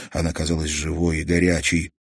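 ordinary delivery

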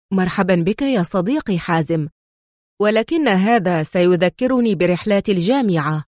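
plain reading voice

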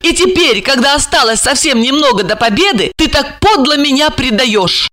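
high-pitched impassioned reproach; high, urgent woman's plea; heated, fervent. A narrator's reading. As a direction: pour it out ardently in a high voice. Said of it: "с жаром заговорила".

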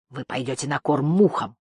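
ordinary delivery, nothing marked

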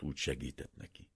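ordinary delivery, nothing marked

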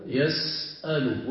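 slow, drawn-out يسأل with the vowel after the س clear. Shown as a demonstration. Teacher showing the present tense to students, slow. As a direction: stretch يسأل slowly so the vowel is heard. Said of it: "shows the present-tense verb form".

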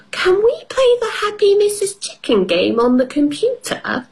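put-on very high excited child voice; little girl voice. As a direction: beg to play like a little girl. Peppa voiced in story.

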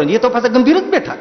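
ordinary delivery

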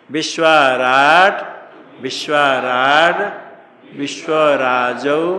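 loud, slow drilled chant of the forms; teacher loudly reciting forms for pupils to copy; loud recitation. Shown as a demonstration. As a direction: call the forms out slowly for the class to repeat. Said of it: "नहीं बोलो, सुन".

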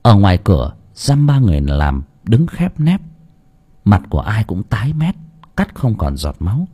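usual speaking voice

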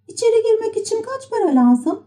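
very high-pitched little puppet voice; Pinokyo. A narrator's reading. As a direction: ask in a high childlike pitch. Pinokyo çocuğa soru soruyor. (tiz kukla çocuk sesi)

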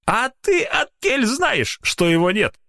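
put-on slow, high, cross old watchman; angry old man. A narrator's reading. Old watchman angrily defending his belief to the doctor. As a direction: snap back at him like an offended old man. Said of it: "сердито произнёс Трофимыч".

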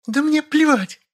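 high-pitched angry female voice; furious bellow. A narrator's reading. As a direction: snap back in falsetto fury. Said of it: "взревела Машенька". (яростный вопль высоким голосом)

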